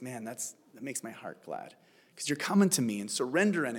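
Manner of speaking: hushed, low, tender murmur; touched, imitated. God imagined receiving worship like a father, hushed and touched. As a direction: softly voice a moved father. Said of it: "puts it on his fridge and says".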